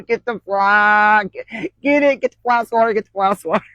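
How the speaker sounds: high, panicky calls for the fly swatter; frantic hollering. Retold or imitated. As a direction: yell at him to grab the swatter, half laughing. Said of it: "I'm hollering at him".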